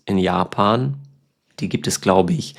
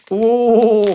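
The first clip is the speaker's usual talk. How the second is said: high, loud startled oh; mock sour surprise; 13 semitones higher, 4 dB louder than usual. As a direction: react as if the sourness hits. Reaction to sour gum.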